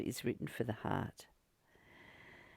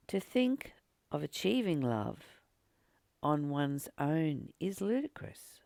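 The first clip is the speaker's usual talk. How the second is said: reading scripture aloud. Acted. Reads verse twelve aloud.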